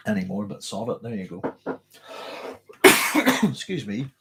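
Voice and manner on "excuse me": ordinary talk, nothing marked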